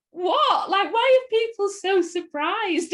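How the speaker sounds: high, loud baffled outburst; exasperated disbelief. Retold or imitated. Actor baffled by people's surprise, high loud disbelief. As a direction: throw up your hands at the fuss. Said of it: "And it was just like".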